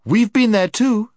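put-on very high, loud, angry girl; snapping. A narrator's reading. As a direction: snap back shrilly in protest. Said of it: "snapped Jill".

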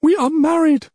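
high, loud outcry as the princess; distraught princess. Acted. Princess insists to the king on her marriage, high outburst. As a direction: cry it out far above your own pitch.

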